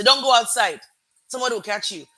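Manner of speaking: loud mock-mother order; imitated parental warning. Retelling what mum said to small children, loud warning.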